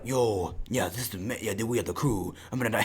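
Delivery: put-on low, soft mumble as a French-accented Gambit; lightly put-on French accent, words run together; mumbled French accent. Gambit greeting the crew, in a low mumbled French accent. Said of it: "He has this, a French accent".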